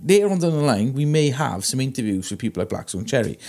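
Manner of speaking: ordinary talk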